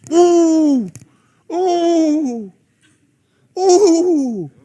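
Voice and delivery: loud, high "ooh" whoops; mock hooting. Preacher teasing women about wanting a man, hooting. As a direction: hoot it out, ending in laughter.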